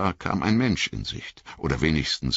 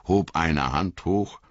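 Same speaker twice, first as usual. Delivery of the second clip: ordinary delivery, nothing marked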